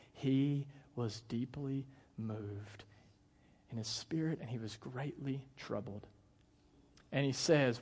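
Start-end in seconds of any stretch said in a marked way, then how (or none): 0.1-6.1 slow, hushed scripture reading; soft and weighty, dropping away; solemn reading. A narrator's reading. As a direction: let the voice sink with sorrow. Solemn reading of Jesus's grief.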